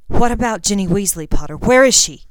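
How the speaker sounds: frantic. Acted. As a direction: demand it in a panic. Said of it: "Even Draco sounded frantic".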